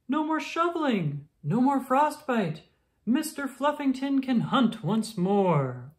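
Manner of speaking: high, excited crowd voice; cheering townsfolk. Acted. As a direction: whoop with relief at spring.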